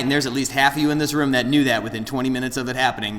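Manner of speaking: usual speaking voice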